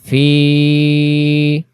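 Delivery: ফি with the ি held; drawn-out fi; long vowel. Shown as a demonstration. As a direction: stretch ফি one alif. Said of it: "খারাজের জন্য এক আলিফ".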